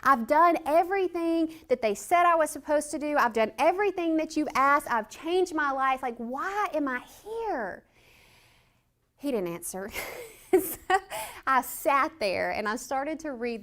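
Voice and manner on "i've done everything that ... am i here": angry at God. Retold or imitated. Prisoner venting at God, high and indignant. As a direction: throw the protest up to heaven. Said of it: "I was angry at God".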